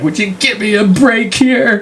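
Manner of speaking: high, hammed-up pleading yell; fed-up parent. Parent to a child who won't stop crying, exasperated yell. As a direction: raise the pitch way up in mock exasperation.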